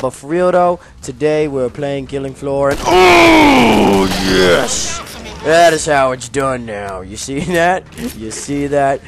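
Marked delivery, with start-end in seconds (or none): 2.7-5.0 higher, louder, stretched-out cheer; triumphant yell. Real, not acted. Gamer cheering a kill mid-intro, stretched yell. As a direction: savour the kill out loud.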